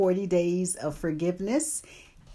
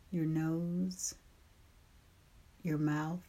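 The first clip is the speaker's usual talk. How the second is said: guided meditation; 6 dB quieter than usual. Acted. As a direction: ease them gently into rest.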